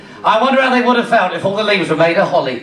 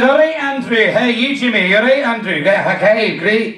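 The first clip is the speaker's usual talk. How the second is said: mock Scottish father; Scottish accent. Father greeting his son, Scottish accent. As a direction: put on a thick Scots patter. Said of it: "He says to the Scotsman".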